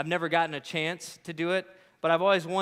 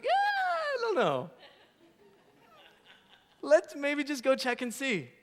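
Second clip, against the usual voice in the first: much higher, squeaky hesitant hint; nervous ride-along rookie, pitched way up; timid high voice; 8 semitones higher than usual. Acted. Rider nudging the eating EMT, timid and squeaky.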